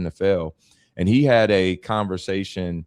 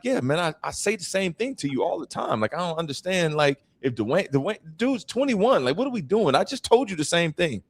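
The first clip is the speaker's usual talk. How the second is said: higher, mock-exasperated dad; a father pretending to be baffled, lightly raised; 11 semitones higher than usual. Father teasing his son about ignored advice, mock exasperation. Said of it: "I'm like".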